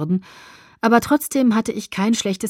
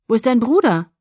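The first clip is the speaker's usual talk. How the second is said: calling out; 4 semitones higher, 6 dB louder than usual. A narrator's reading. Shouting over loud music.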